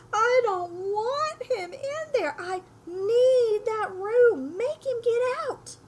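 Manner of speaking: high, shrill-pitched loud wail, slow and tearful; shrieking Aunt Petunia. A narrator's reading. Teacher reading Aunt Petunia's outburst, high and loud. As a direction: wail it like a spoiled child's mother. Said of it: "the sound of Dudley bawling and his mother".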